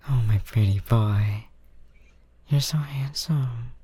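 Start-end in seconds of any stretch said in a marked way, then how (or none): 2.5-3.7 slow tender whisper. Acted. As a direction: breathe the compliment softly. Tender praise while comforting.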